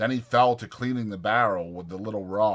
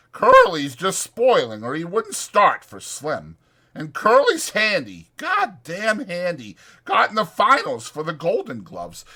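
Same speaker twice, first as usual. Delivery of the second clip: higher, louder eager ranch hand; chatty ranch hand selling a night in town; Whit's voice; 8 semitones higher, 5 dB louder than usual. Acted. Voicing Whit.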